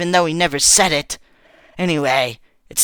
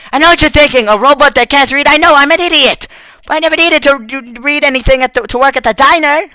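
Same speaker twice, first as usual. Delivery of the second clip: high, loud self-mocking frustration; frustrated outburst; 8 semitones higher, 4 dB louder than usual. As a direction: shout it, angry at yourself. Robot ashamed it can't read.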